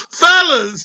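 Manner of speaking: fellas in a sudden high squeal. Acted. Addressing the fellas in a high squeal.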